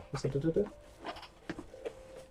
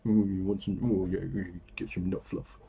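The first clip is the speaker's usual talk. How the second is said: imitating Darren; 4 semitones lower, 3 dB quieter than usual. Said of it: "Sounds like Darren".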